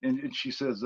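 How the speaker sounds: ordinary talk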